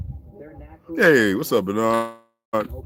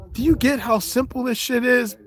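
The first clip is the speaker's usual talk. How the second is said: much higher, pointed question; rhetorical challenge; 13 semitones higher than usual. Real, not acted. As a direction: press the audience, voice lifted high. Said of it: "rhetorical challenge to audience".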